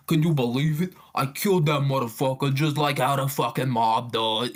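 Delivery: lightly voiced Italian gossip, pitch lifted; blabbing Italian mobster. Italian killer blabbing to everyone, mock boasting. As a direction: brag about it like a hint of a mock mobster. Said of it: "Italian in and of itself, he's blabbing".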